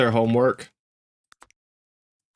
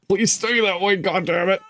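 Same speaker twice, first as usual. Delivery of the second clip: pleading outburst; 7 semitones higher than usual. Retold or imitated. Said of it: "So I'm like".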